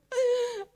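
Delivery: quick high laugh; a bright burst of laughter; short laugh. Real, not acted. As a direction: laugh at your own joke. Preacher laughs at own joke.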